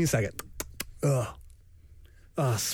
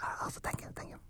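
whisper; 15 dB quieter, faster than usual. Pretend. Thief whispering.

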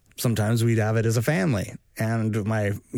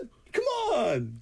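put-on much higher, whining kid voice; bratty kid; 9 semitones higher, 4 dB quieter, slower than usual. Co-host joining the bit as the son, high whine. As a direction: whine at dad like a sulky teen.

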